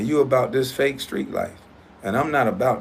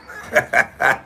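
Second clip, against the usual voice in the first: short laugh; 3 semitones higher than usual. Real, not acted. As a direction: laugh shortly at your own joke. Mocking laugh after joke about assets.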